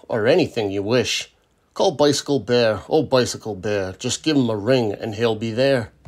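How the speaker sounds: usual speaking voice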